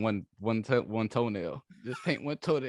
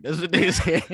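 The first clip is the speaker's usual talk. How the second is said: louder and higher through laughter; laughing; 7 semitones higher, 5 dB louder than usual. Real, not acted. Mocking listeners' reaction to his cohost, laughing. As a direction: laugh as you say it.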